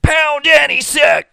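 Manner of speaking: high, loud full-voiced shout; shouted slogan. Breaking into the chat to yell a slogan, high loud shout. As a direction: yell it out like a rallying cry.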